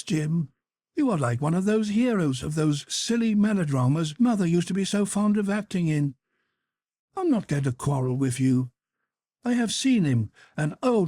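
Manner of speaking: a teasing sister scolding her brother; young Sybil, gently scolding. Acted. Sybil to her jealous brother, playfully scolding.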